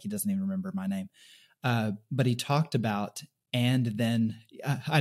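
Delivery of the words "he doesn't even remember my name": quick, lower mock complaint; imitating the guy. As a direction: toss off his grumble fast and low. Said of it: "He's gonna listen to this and be like".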